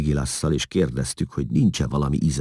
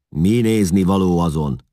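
loud, raised, grumpy question; grumbling; 5 semitones higher, 7 dB louder than usual. A narrator's reading. Eszlasz grumbles at the gawking men, cross and loud. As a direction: grumble at them impatiently. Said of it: "dörmögött Eszlasz".

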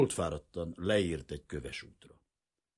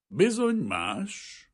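slow, sighing regret; 7 dB louder, much slower than usual. Acted. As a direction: let it out on a long, rueful sigh. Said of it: "sóhajtott Vrana Basil".

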